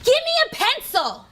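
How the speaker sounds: mock rude demand for a pencil. Shown as a demonstration. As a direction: snap it out rudely. Teacher acts out rude demand.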